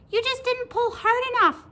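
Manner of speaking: high, loud squeaky creature; loud high-pitched cartoon voice; little pigtail voice. A narrator's reading. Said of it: "said the pigtail".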